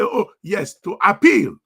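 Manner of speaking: usual speaking voice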